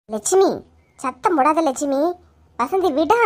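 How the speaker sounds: ordinary talk, nothing marked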